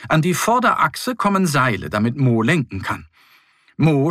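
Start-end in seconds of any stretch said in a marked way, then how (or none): none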